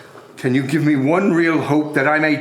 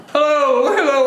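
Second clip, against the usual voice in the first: joyful calling; 9 semitones higher than usual. Acted. Scrooge wakes joyful and calls out.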